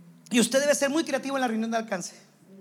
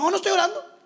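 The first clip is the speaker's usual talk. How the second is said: higher, louder, shameless admission; brazenly cynical; 9 semitones higher, 5 dB louder, faster than usual. Retold or imitated. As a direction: admit it without shame. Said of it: "Se vuelve cínico". (confesión descarada, más aguda y fuerte)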